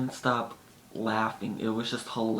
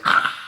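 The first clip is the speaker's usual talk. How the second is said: loud short scream imitating a horror film; horror movie scream; 10 dB louder than usual. Imitating the friend's horror-movie scream at the video. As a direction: shriek like a scared movie victim. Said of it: "horror movie style".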